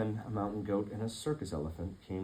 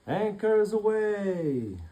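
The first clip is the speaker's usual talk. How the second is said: long, slow, high call; triumphant cry; 13 semitones higher, 8 dB louder, much slower than usual. Acted. Goat shouting as the stuck boat lifts, high and drawn out. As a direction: sing it out as the ship breaks free.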